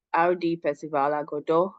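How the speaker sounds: ordinary delivery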